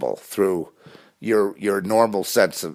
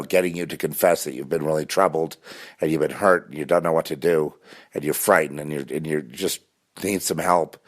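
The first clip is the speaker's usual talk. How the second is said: ordinary talk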